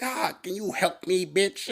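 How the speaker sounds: high, drawn-out mock plea; pimp with a hurt back. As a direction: put on a pained pimp asking for help.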